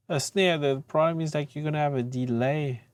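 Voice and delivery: usual speaking voice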